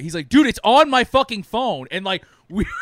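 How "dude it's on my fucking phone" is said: heated retort. Retold or imitated. Retold friend's heated reply.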